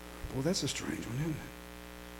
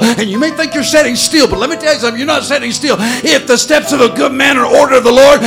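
loud, rising, chanted sermon climax; preaching at full force; 6 semitones higher, 17 dB louder than usual. Acted. Preacher rallying the congregation to go forward, full-force shouting. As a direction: drive it home like a revival preacher.

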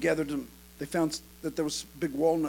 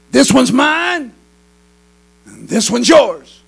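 louder, drawn-out calling voice; eerie overheard call; 8 semitones higher, 17 dB louder, much slower than usual. Acted. Boys dividing walnuts overheard by passer-by, drawn-out calling. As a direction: stretch each phrase slowly, projected for effect.

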